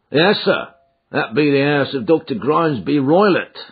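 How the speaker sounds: a hint of a rustic driver; lightly voiced driver. A narrator's reading. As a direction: answer as an obliging country driver. Driver answers in the story.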